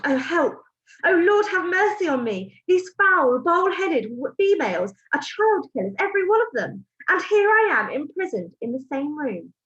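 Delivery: put-on a boy trapped and terrified, praying in panic; panicked boy. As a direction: let the panic rise in his head. Boy's panicked inner voice.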